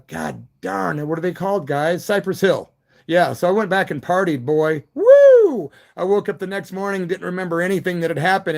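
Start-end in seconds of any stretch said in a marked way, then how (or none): 4.9-5.7 a high, loud party whoop; excited whoop. Real, not acted. Streamer whoops loud to his live viewers about partying. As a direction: let out a wild celebratory woo. Partying like rock stars, cheering.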